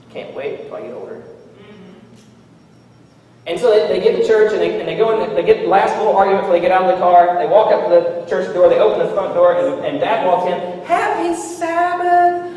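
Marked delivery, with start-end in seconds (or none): 0.1-1.2 whispered grumble from the back seat; kids whispering. Retold or imitated. 10.8-12.4 sugary mock Sabbath welcome; fake cheery churchgoer. Hypocritical dad greeting at church.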